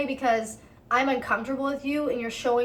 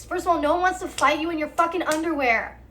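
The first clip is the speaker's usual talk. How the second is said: normal talking voice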